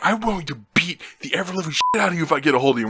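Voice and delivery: fast, high imitated rage; screaming mad. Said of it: "He was screaming".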